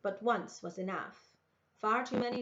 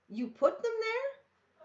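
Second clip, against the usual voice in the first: high, slow appalled question; shocked; 5 dB louder, slower than usual. A narrator's reading. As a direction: stretch out the shock. Girl shocked by grubs in wound.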